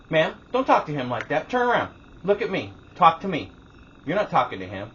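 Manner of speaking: loud, high-pitched reprimand; imitated stern scolding. Manager orders rude customer to face her, imitated scolding. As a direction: act out a manager firmly taking charge.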